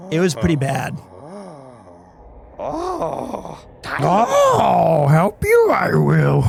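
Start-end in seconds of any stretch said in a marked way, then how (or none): 2.6-6.4 a failed, lightly voiced Yoda; attempted Yoda. Streamer trying a Yoda voice for a game character. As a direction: try for Yoda and give up laughing. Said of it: "I can't do that voice".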